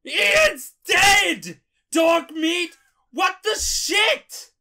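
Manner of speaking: very high, loud mock wail; hammed-up anguished shouting. Player telling the dog Ian's dead, hammed-up shout. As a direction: scream it in exaggerated grief and disbelief.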